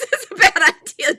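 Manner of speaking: high, loud laugh through the words. Real, not acted. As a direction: laugh it out, amused at her own joke. Joking warning to her chat, laughing.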